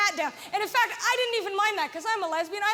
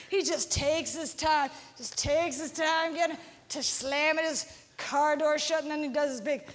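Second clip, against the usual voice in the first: lower, slow, leisurely drawl; slow mock cop; 5 semitones lower, slower than usual. Comedian mimicking the radar cop slowly taking his time. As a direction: drag it out like the cop strolling.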